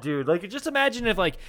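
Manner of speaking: ordinary talk, nothing marked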